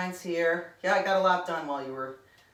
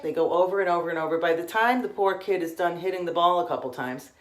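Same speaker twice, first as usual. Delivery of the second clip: normal talking voice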